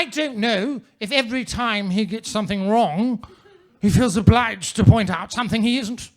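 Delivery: ordinary delivery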